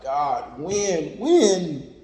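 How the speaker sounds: pleading, high and loud; inner cry to God. Retold or imitated. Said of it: "you gonna cry on the inside".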